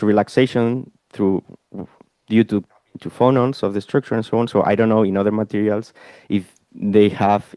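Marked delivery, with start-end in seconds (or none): none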